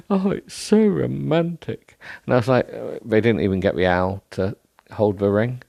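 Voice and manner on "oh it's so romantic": a hint of a high, slow swoon; lightly voiced gushing crowd; mock swooning crowd. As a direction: coo it like a swooning onlooker. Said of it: "everyone was like".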